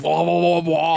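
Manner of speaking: usual speaking voice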